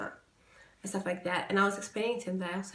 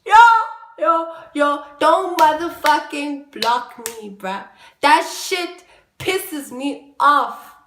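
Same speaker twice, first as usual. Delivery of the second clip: loud slow emphatic anger; angry loud rant; 7 semitones higher, 12 dB louder, slower than usual. Real, not acted. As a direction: pound each word out angrily. Angry rant about being blocked.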